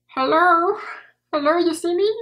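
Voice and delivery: high-pitched hello to camera; high questioning call. Real, not acted. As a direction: call out, checking you're seen. Checking camera sees her.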